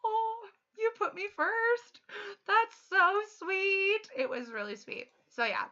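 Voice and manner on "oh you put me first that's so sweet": high, slow, melting response; teacher touched that the kid put her first, melting slowly. Retold or imitated. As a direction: sound moved by the compliment. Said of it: "And I was like".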